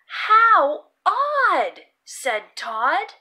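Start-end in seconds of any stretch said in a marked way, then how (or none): 0.1-1.9 higher, louder puzzled exclaim; bright wondering exclamation; surprised "how odd". Acted. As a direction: marvel at the odd sight. Todd remarks on odd sight.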